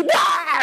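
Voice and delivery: a mock seizure shout; convulsion cry. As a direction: blurt out the boy's seizure noise. Mimics the boy's convulsion.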